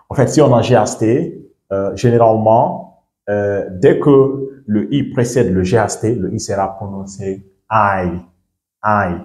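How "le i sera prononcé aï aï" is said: the sounded I said "eye"; English long I. Shown as a demonstration. Teacher explaining the GHT rule, final I said "eye". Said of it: "Ça va prendre son son en anglais".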